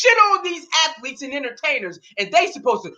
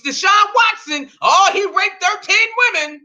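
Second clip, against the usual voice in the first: higher mocking shout; mock fan outcry; 6 semitones higher than usual. Ranting host mocks a fan, mock shouting. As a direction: yell it like a self-righteous fan piling on. Mocking a hostile fan's hot take.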